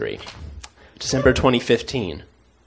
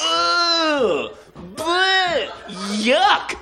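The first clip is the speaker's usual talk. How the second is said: very high disgusted ugh, bleh, yuck; much slower than usual. Pretend. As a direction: retch out the disgust, squealing. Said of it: "avoid rewarding this".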